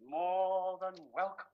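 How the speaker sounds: slow, drawn-out assurance; magnanimous and unhurried; expansive welcome. Acted. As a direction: stretch it out generously. Grandly welcomes son's fiancée.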